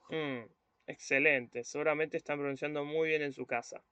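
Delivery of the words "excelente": ordinary talk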